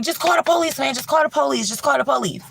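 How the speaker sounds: high, insistent plea; urgent pleading. Retold or imitated. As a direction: beg them to call. Begging hosts to call police.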